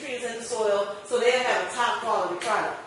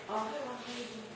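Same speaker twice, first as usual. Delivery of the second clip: soft, unsure customer voice, well below normal level; timid mumble; 14 dB quieter than usual. Retold or imitated. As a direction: mumble it like a shy seller with no confidence. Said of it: "or you're saying".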